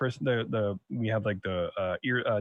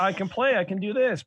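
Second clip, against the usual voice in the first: imitating toddler; 7 semitones higher than usual. Toddler voiced, higher.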